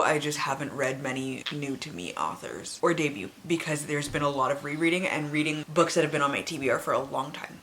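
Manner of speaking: ordinary talk, nothing marked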